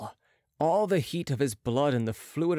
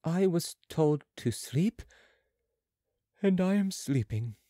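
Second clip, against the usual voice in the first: slow, higher-pitched, untroubled patient; perfectly unruffled; 4 semitones higher than usual. A narrator's reading. Mesmerised patient answers Marat, slow and unruffled. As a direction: answer evenly, as if asleep. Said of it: "in a perfectly unruffled voice".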